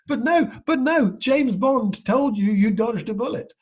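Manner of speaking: high, loud, slow protest; incredulous friends. Retold or imitated. Friends protesting to the lad, high loud disbelief. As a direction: squeal it in disbelief, slowly. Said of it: "And people said".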